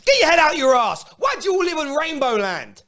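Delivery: real exasperated shout; angry shout. Real, not acted. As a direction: bark it at the deluded fans. Ranting at Chelsea fans.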